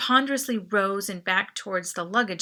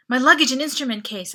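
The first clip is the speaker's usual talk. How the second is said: demanding; 3 semitones higher, 7 dB louder, faster than usual. A narrator's reading. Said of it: "I demanded".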